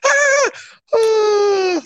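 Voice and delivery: high breathy "ah, ah" to cut off a laugh; clipped high laugh stop; laugh ending. Shown as a demonstration. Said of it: "That's the way I end my laugh".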